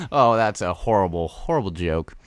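normal talking voice

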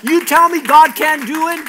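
preacher's shout. Real, not acted. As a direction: fire it out at the congregation. Preacher calling out to congregation.

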